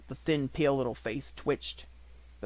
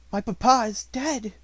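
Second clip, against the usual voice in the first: louder, higher grieving cry; 9 semitones higher, 7 dB louder than usual. A narrator's reading. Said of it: "saying over and over again".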